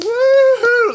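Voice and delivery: loud, high whooping cheer; excited whoop. Real, not acted. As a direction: let out a big victory whoop. Cheering a rumor with applause.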